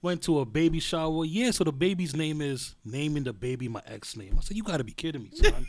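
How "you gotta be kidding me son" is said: incredulous, with "son" jumping much higher and louder; disbelief. Retold or imitated. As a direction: sell the shock on the last word. Ex's name at baby shower.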